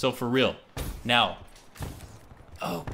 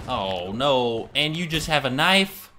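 usual speaking voice

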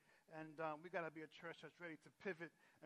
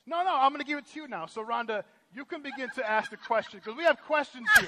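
loud, high, eager; excited handover; 7 semitones higher, 18 dB louder than usual. Real, not acted. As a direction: hype up the interview handover. Pastor handing over, excited.

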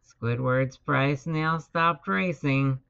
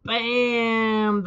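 high, punched sound-effect word; sudden high burst; crash exclamation; 6 semitones higher, 3 dB louder than usual. A narrator's reading. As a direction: hit it like the crash itself. Narrator voices the crash.

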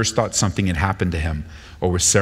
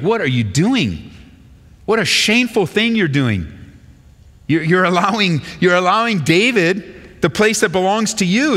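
indignant rebuke; 9 semitones higher than usual. Acted. Paraphrasing Saul rebuking Jonathan.

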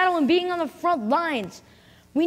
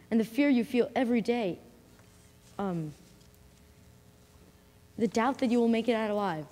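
ordinary talk

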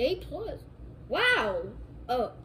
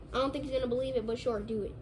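normal talking voice

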